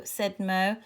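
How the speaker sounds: ordinary delivery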